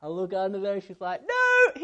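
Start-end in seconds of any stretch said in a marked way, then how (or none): 1.3-1.7 put-on high, loud little-girl squeal; child's squealed no. Dad imitates his daughter squealing from under the slide. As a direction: shriek it like a delighted little girl.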